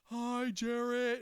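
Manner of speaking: loud, high, very drawn-out greeting; calling from afar. Retold or imitated. Greeting coworker from afar, drawn-out call. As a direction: stretch the name out in a holler. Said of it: "like, from afar".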